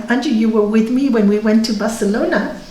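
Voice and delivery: normal talking voice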